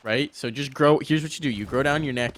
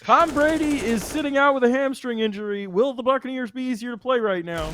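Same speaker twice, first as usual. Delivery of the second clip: very high, loud cartoonish reporter voice; mock reporter; 12 semitones higher, 4 dB louder than usual. Friend mocking a weakened opponent as a reporter, very high. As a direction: squeak out a silly press question.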